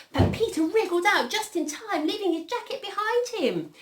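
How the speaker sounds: high, animated storytelling; story narration. A narrator's reading. Reader telling children of Peter wriggling free, excited storytelling. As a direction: lift pitch and volume for the narrow escape. Reads a picture story aloud.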